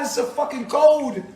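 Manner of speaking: ordinary talk, nothing marked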